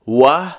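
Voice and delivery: و said as a rounded w, lips pursed. Shown as a demonstration.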